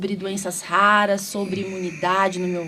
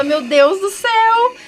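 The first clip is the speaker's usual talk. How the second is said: shocked exclamation; 11 semitones higher, 7 dB louder than usual. Retold or imitated. A viewer seeing the dancing priest online, mock astonishment. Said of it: "e você fala".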